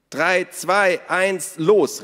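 hoch und laut gezählt; rufend. A narrator's reading. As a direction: die Kinder zählen den Start ein. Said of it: "riefen Ada und Naru". (laut gerufener Countdown)

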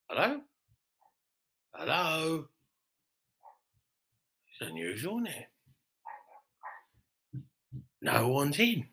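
quietly calling into an empty pub; calling into empty room. Acted. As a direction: call out softly into an empty pub.

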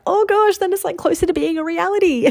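high, loud squeal of alarm; mock panic. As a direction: squeal it like a comic flap of nerves. Acts out her own past panic.

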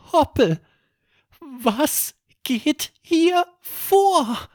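put-on high, slow, bewildered child's voice; bewildered boy. A narrator's reading. Erik asks Hoppel in the dark, high and slow. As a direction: ask slowly, lost in the dark.

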